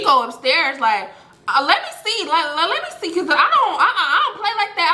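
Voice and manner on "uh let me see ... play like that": high, stammering mom voice with a laugh in it; mock motherly fussing, speeding up; indignant mother. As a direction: act out a fussing mom, pitched up. Mama goes upstairs to check.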